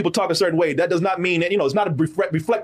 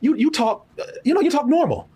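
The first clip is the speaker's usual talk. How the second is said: higher mock voice, patronising; imitating the woman; 8 semitones higher than usual. Quoting the woman who says he talks normal, higher mock voice. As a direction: lift the pitch and mimic her praise. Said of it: "She said".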